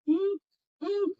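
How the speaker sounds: "उंक" hummed through the n, ending in k; nasal nk sound. Shown as a demonstration. Teacher showing the NK sound, slow nasal "उंक". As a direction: slowly sound out "उंक", n running into k. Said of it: "न मधून काढायचा आणि क चा उच्चार करायचा".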